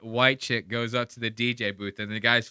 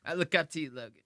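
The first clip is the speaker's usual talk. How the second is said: mock crying; 3 semitones higher, 5 dB quieter, much faster than usual. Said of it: "Sound like you're gonna cry".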